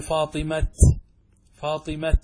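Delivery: فاطمة stopped on a t like non-Arabs say it; foreign-style t ending. Shown as a demonstration. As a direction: stop on فاطمة with a hard ta. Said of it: "كما يقوله بعض العجم". (فاطمة بالوقف على التاء)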